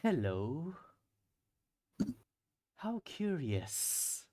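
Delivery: slow, soft, otherworldly voice; a layered voice in your head, very slow; eldritch horror. Acted. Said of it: "a feminine voice inside your head that goes".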